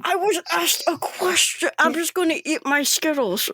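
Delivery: high-pitched protest through a mouthful; muffled, petulant mouthful voice; mouth full of candy. Acted. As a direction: defend yourself while chewing. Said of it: "He has, like, a handful of Skittles".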